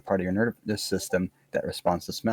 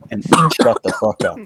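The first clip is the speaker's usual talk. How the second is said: loud, irritated snap at friends; annoyed outburst; 2 semitones higher, 8 dB louder than usual. Real, not acted. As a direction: cut the chatter off sharply. Snapping at chatting friends.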